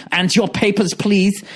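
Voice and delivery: playful imitation of a border guard; mock checkpoint official. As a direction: put on a hint of an officious document checker. Mimics an official demanding documents.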